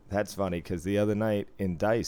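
usual speaking voice

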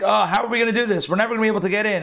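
high, fast mock-panicked whine; whiny panic. Frightened Israelites complain to Moses, high fast mock whine. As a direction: squeak out the people's hopeless worry in a hurry. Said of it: "People are like".

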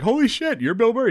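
a cop suddenly turning into a fan; mock starstruck cops. Police meeting Bill Murray with his tambourine, starstruck and high.

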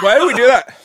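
high, loud wail of regret; pained outcry. Real, not acted. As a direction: cry out in real agony from the chip. Suffering from the spicy chip.

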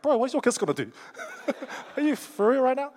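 high, loud mock baby retort; comic high-pitched voice for a fed-up baby; indignant baby. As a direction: pipe up as an offended baby, hammed up. Voicing his crying baby daughter's retort.

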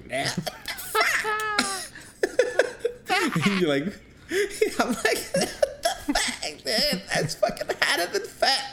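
very high voice breaking into laughter; high-pitched laughing rant to his friend about the wrong address; laughing squeal. Retold or imitated. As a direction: squeak out the complaint while cracking up laughing. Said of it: "reach out to L J and was like".

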